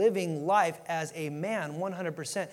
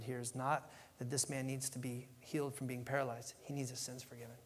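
quiet earnest point; 6 semitones lower, 8 dB quieter than usual. Real, not acted. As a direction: lean in and tell them quietly. Preacher drops to earnest quiet.

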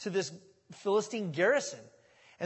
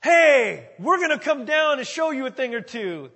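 loud, higher jeering call; taunting shout; 6 semitones higher, 11 dB louder than usual. Acted. Philistines shouting down at the two, loud higher jeer. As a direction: yell it down the cliff to provoke.

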